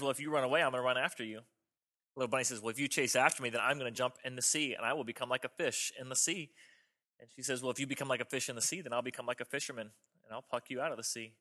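low, soft bedtime-story voice; gentle storybook bunnies. Acted. Preacher retells a children's bunny story to the congregation, low and soft. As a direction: read it softly, like to a child.

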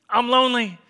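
high, loud mock-desperate wail; desperate pleading. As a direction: beg like you can't be alone tonight. Acted desperate lonely man pleading.

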